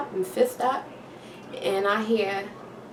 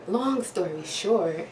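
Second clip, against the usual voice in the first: drawn out; slower than usual. Shown as a demonstration. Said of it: "nah, I'm gonna stretch this out".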